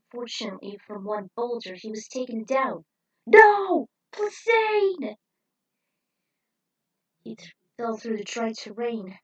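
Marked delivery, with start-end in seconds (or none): 3.2-5.1 very high, very loud despairing scream; anguished scream. Acted. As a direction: scream the name as he falls. Creature taken down.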